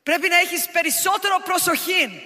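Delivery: scolding Greek mother's warning. Retold or imitated. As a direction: urge like a worried Greek mum. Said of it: "my mother would always say".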